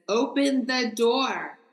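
retold demand, each word pushed out; impatient command. Stranger at the door to the woman inside, impatient command.

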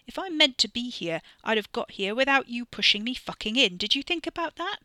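loud, rising angry challenge; mounting indignation at his cousin; heated argument. Acted. Ricky argues angrily.

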